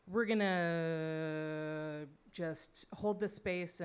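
long drawn-out "gonna"; imitating an adult at a loss, slowly; stalling counselor. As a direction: stretch the opening out while searching for advice. Unsure counselors stall for an answer.

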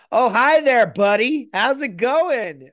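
high, loud, hammed-up American drawl; mock American accent. Mate back from two weeks away in America, mock American accent. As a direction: put on an over-friendly American accent. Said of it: "like, America for two weeks and is like".

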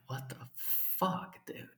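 breathy whispered what the fuck; whispered disbelief. Retold or imitated. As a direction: mutter it in stunned disbelief under your breath. Standing stunned after he leaves.

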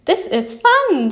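high, gleeful storybook exclamation; excited. A narrator's reading. Big Bear splashing with his brother, excited. Bears playing in the puddles.

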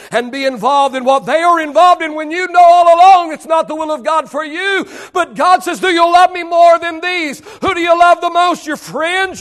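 loud, high preaching cry; shouting preacher. Acted. Preacher demanding loyalty from the congregation, loud shouting. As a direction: hammer each question to the back row.

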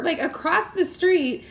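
normal talking voice

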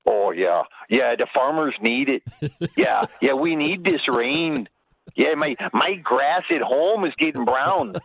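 slow, drawn-out imitation of a Wisconsin accent; Gantner. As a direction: imitate a Wisconsin teammate, stretching the words. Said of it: "Gantner's the only one going".

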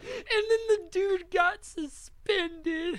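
high, loud, slow words through laughter; cry-laughing. Real, not acted. Telling friends the guy got suspended, cry-laughing high and loud. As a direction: squeal it out while laughing. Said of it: "cry-laughing over the story".

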